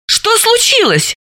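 very high, drawn-out surprised question. A narrator's reading. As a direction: ask in astonishment, pitch jumping up. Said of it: "удивилась Далила". (удивлённо, высоким голосом)

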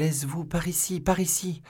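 high-pitched, breathless-with-hope call; eager calling. A narrator's reading. Young woman guiding her rescuer, high eager call. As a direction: call him over in a high voice.

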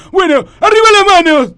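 grito imperioso de ladrón; ordená fuerte, como en un asalto; orden de asalto. Acted. (asaltante gritando una orden)

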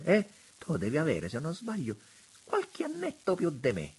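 high delighted exclamations of a fawning prelate; gushing prelate. A narrator's reading. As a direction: coo over the view like a delighted old monsignor. Monsignore chats with Cosmo.

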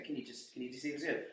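sad, meek. Retold or imitated. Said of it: "they all sounded really sad".

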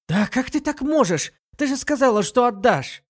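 flustered, pitch jumping up; nervous. A narrator's reading. Murong Qiu nervously protests her friend broke her promise. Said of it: "Мурон Кью занервничала".

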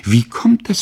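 put-on boy pressing the gentlemen for an answer; eager child's question. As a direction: ask it like a puzzled, excited boy. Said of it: "fragte ich zurück".

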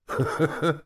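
short high chuckle. A narrator's reading. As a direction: laugh briefly at your own joke. Character's laugh at own joke.

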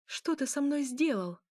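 plain reading voice

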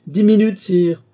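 high, loud counter-demand; sharp ultimatum. A narrator's reading. Countess refusing the king two hours' reflection, sharp. Countess cuts down the king's delay.

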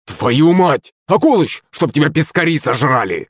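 mock yell of an outraged fisherman; straining angry yell. A narrator's reading. Захарыч cursing Акулыч from his boat, yelling himself hoarse. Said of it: "надрывался Захарыч".

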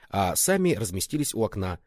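ordinary delivery

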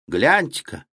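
lightly voiced small boy; a hint of a small child's voice. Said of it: "раздался вдруг детский голос Вани".

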